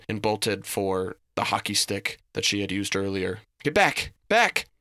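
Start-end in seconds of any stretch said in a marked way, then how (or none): 3.6-4.7 high, loud, stammering warning; panicked threat. A narrator's reading. Reese brandishing a hockey stick at the creature, panicked. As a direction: ward off an intruder in a stuttering panic.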